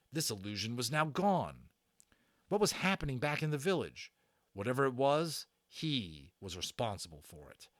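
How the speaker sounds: usual speaking voice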